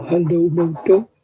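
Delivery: slow, drawn-out hello like the phone greeting; a light take on the phone's jingle voice; imitating phone startup. Telling viewers what her rebooting phone will say, mimicking it. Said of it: "It'll go".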